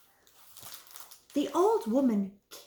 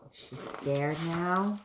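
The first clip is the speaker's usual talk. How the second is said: plain reading voice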